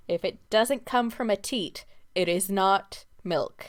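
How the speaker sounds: deliberate and firm, pressing each word; slow emphatic ruling. Real, not acted. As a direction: spell it out slowly, as a final verdict. Ruling on what counts as milk.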